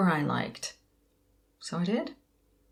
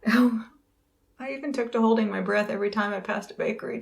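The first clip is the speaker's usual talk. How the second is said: normal talking voice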